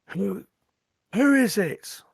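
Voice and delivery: high-pitched challenge called from the wall; calling out. Acted. Gate guard to the arriving rider, calling out. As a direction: call down sharply to a stranger below.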